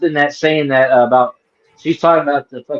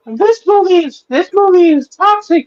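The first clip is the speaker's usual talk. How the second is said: very high, mock-outraged reviewer screech; Grace Randolph; 3 dB louder, slower than usual. Predicting the reviewer's pissed-off rant, high mocking impression. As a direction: imitate an outraged critic, pitched way up. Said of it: "what Grace Randolph's gonna bitch about".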